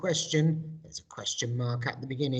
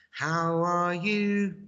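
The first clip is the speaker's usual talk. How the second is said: slow, clear teacher model; slow classroom call; slow model question; 5 semitones higher, much slower than usual. Shown as a demonstration. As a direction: draw each word out for the class. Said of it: "I do the English".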